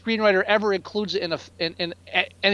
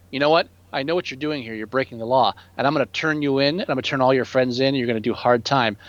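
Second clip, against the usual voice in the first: lower, menacing film-character voice; mock movie threat; 7 semitones lower than usual. Mocking a film character threatening to report them, in a low menacing voice. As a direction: play the guy who'll turn them all in.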